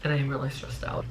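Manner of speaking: soft, low, deflated; low, soft, stressed-out voice; disappointed. Retold or imitated. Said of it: "but I was just like".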